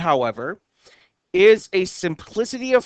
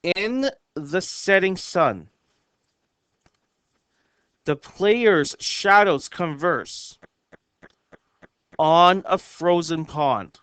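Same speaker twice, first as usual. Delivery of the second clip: poem reading; slower than usual. Shown as a demonstration. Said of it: "And it says".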